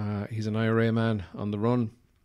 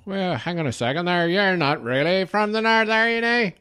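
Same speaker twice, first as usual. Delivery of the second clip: high, loud mock Northern Irish challenge; hammed-up Northern Irish drinker, rising in pitch; Northern Irish accent; 9 semitones higher, 6 dB louder than usual. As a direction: call someone out in a broad Northern Irish lilt. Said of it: "a bunch of Northern Ireland people, they might be like".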